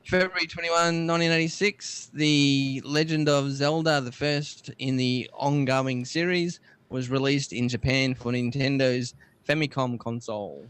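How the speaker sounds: presenter reading a history bulletin; read-out history item. Acted. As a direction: announce the entry with deliberate pacing. Reads out tech history item.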